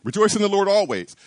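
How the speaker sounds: "Rejoice in the Lord always." run off fast, weight on "rejoice"; quick recitation. Shown as a demonstration. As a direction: rattle the verse off briskly. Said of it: "Here's the command".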